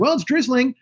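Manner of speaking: high-pitched mock woman reporter; imitated weather reporter. Woman reporting live in rain, mock high voice. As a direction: lift your voice high to mimic a TV reporter.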